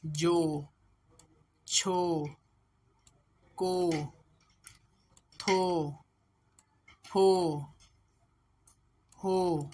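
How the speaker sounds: जो, छो, को, थो, फो, हो each firm with long "oh"; syllable drill. Shown as a demonstration. Teacher models the vowel on each consonant, drawn-out जो to हो. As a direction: sound out जो, छो, को, थो, फो, हो, pausing after each. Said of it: "ये ओ की sound ही देगा".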